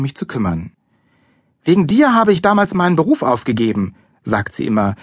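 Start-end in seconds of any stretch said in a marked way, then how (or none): 1.6-4.0 high, accusing complaint; a mother's well-worn angry reproach. A narrator's reading. Mother blaming son for lost career, angry. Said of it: "richtig wütend auf mich ist".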